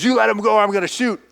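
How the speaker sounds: high-pitched mock threat; acted threat. Boy with shotgun warns attackers, high urgent threat. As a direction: raise the voice in a retold warning.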